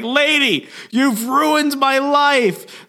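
high-pitched, drawn-out outraged wail; hammed-up furious accusation; mock yelling. Peter in Wolverine's body yelling at Jean Grey, mock outraged yell. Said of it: "Peter Wolverine's body starts yelling".

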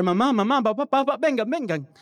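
high, loud calls for help; panicked yelling. Retold or imitated. Boy screams for his parents over the iguana, panicked. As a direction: shout for mom and dad in alarm. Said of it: "empecé a gritar".

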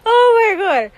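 loud, very high-pitched exclamation; real astonishment. Real, not acted. Host reacting to the mother's embarrassing story, astonished outburst. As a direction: burst out, starting high and falling.

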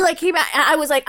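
normal talking voice

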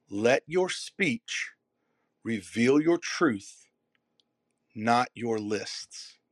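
each phrase slow, punched on your; slow emphatic repeat. Shown as a demonstration. Repeating the line slowly for the audience. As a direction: lay it out slowly, stressing your. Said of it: "One more time".

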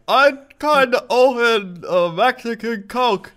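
high loud slow sheepish voice; mock innocent voice. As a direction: play it guilty and squeaky. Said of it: "And I'm like".

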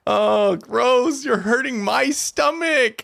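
high, drawn-out disgusted whine; grossed-out whine. Real, not acted. Co-host queasy at talk of stomach acid, grossed-out whine. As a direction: groan it out in high-pitched disgust.

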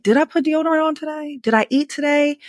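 high, fretting self-talk, pitched well up; panicking mom checking herself over in the mirror; worried inner voice. Retold or imitated. Tired mom doubting herself at the mirror, fretting high. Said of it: "And you're like".

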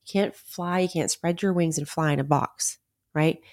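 ordinary talk

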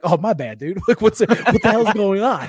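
laughing apology; giggling through the question. Retold or imitated. Laughing while quoting himself.

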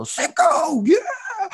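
put-on high, loud child cheer; excited kid shout. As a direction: cheer it out like a proud little kid. Child reading sign, excited.

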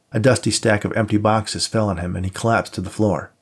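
usual speaking voice

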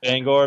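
"Bangor" drawn out, with full -gore ending; "Bangor" with a clear final or sound; local pronunciation of Bangor. Shown as a demonstration. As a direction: say "Bangor" slowly as BANG-gore. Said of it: "most people around here say Bangor".

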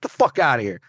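loud, high acted outburst; angry challenge. Woken man to intruder, loud mock anger. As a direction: imitate an irate man woken up.

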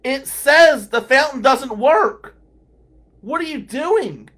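loud, high, incredulous rebuke; acted-out exasperated yell; exasperated shouting. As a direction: yell it slowly at someone who ignored the sign. Acted quarrel over broken fountain.

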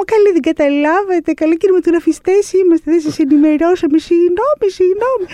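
high, squeaky mock-innocent voice; high sing-song feigned innocence, calling out to armed police; playing the innocent. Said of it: "κάνω εγώ τα δικά μου".

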